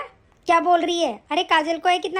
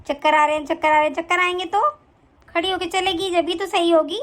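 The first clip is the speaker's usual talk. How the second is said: put-on lower, scolding older woman's voice; mother-in-law bossing her son's wife, lowered scolding voice; nagging mother-in-law; 5 semitones lower than usual. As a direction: berate the bahu like a harsh saas. Complains to son.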